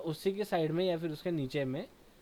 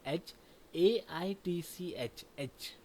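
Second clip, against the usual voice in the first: slow letter spelling; 3 semitones higher, much slower than usual. Shown as a demonstration. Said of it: "देखो एच को कैसे pronounce किया".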